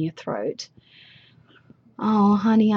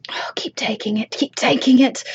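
whisper; 4 semitones higher than usual. Acted. Urging whisper.

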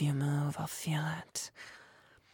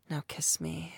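ordinary talk

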